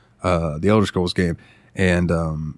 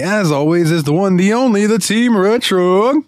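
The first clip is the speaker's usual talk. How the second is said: rising, higher and higher build to the name; hype intro; 11 semitones higher, 3 dB louder than usual. Real, not acted. Host introducing his co-host, building hype announcer style. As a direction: drag out the name like a ring announcer.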